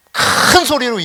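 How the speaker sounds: 큰 drawn out long and loud; emphatic stress on 큰. Real, not acted. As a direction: stretch out 큰 with force, then 소리로.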